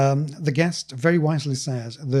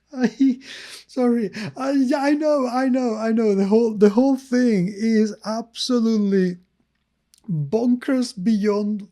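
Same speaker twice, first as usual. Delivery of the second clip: higher and breathless with laughter; laughing; 6 semitones higher, 3 dB louder than usual. Real, not acted. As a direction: talk through a fit of giggles. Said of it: "I can't stop laughing right now".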